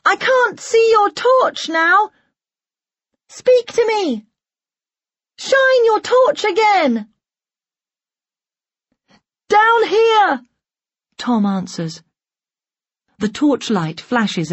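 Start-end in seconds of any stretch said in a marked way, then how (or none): 0.0-7.1 high-pitched calling across a distance; shouting. A narrator's reading. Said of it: "Lisa shouts". 9.5-10.4 high, loud answering cry; calling back. A narrator's reading. Trapped Tom calls back for help.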